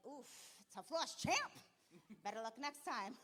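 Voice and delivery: smug taunt. Retold or imitated. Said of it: "walking up to the losing coaches like".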